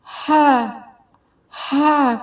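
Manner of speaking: normal talking voice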